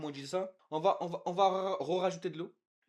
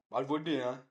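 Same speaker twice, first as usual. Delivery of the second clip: usual speaking voice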